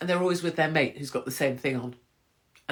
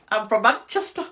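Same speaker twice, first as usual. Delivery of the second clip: louder mock Manchester lad, I'm from Manchester; 6 semitones higher, 6 dB louder than usual. Shown as a demonstration. Doing a Manchester for viewers, louder and hammed-up. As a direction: ham up a Manchester bloke saying I'm from Manchester. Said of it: "can I do a Manchester?".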